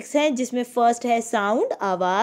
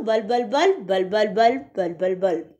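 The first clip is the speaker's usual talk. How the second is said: fast repeated sound word; 3 semitones lower than usual. Shown as a demonstration. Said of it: "इसको तेज तेज पढ़ूं".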